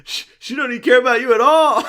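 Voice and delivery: high, loud taunt; mock holler with comic insistence; yelling off the phone. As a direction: heckle her from the background. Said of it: "yelling from, like, off the phone".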